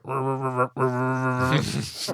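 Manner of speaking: rumbling engine imitation; warbling vroom of a car; imitated car noise. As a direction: make a car sputtering sound with the mouth. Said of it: "and you hear".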